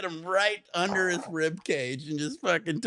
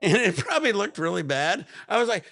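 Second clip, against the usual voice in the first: ordinary talk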